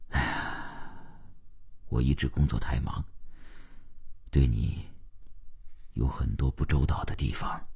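soft, low, halting admission; sigh, then own up quietly with pauses; deep-breath confession. A narrator's reading. Husband owns up to neglecting his wife, soft halting confession. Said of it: "邓文轩深吸了一口气".

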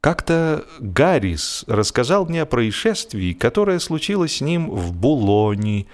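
ordinary delivery

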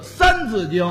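三字经 said crisply, no erhua; each syllable of 三字经 clean and exact; clear standard pronunciation. Shown as a demonstration. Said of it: "您说话怎么还倒音切字的".

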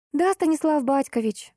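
lofty mock-formal reply; высокопарно. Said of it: "также высокопарно отвечаю я". (высокопарный ответ)

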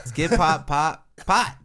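loud, choppy backwards syllables; backwards speech. Pretend. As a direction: blurt the title backwards. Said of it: "say Top Pop Topics backwards".

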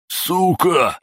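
resentful yelp. A narrator's reading. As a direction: cry out the insult like a wounded kid. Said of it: "обиженно выкрикнул один из парней". (обиженный выкрик)

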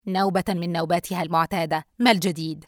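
cold. Acted. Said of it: "وقال ببرود". (ببرود)

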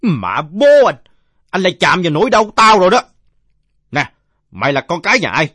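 put-on loud, high drunken bluster; indignant drunkard. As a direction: bellow like an offended old drinker. (giọng ông say rượu quát to, tự ái)

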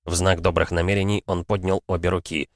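ordinary delivery

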